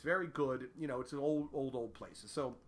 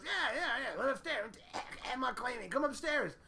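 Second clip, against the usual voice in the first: Bob impression; 7 semitones higher than usual. Bob voice again.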